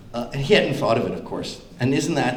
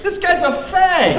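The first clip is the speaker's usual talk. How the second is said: higher, slower mock aside; lightly imitated Trump; hammed-up sneer; 7 dB louder, slower than usual. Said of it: "he's like".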